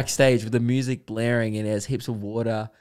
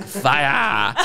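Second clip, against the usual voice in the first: drawn-out, louder cartoon sloth cry; sloths from Ice Age; 4 semitones higher, 6 dB louder than usual. Comic telling of the sloths in Ice Age Two, drawn-out sloth cry. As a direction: cry it out long like an animated sloth. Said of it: "all the sloths are like".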